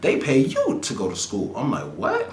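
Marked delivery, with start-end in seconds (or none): 0.0-1.5 friend's voice quoted. Retold or imitated. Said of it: "He's like". 1.9-2.3 high shocked squeak of what; stunned what in a high rising pitch. Retold or imitated. Shocked reply to free school.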